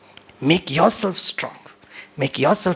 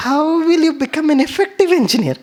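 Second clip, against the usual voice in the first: higher, very slow, affectionate; lovingly; 11 semitones higher, much slower than usual. Shown as a demonstration. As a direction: ask it kindly, drawn out. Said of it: "बड़े प्यार से बोलने लगा". (छात्र से ऊँची, बहुत धीमी, प्यार भरी आवाज़ में)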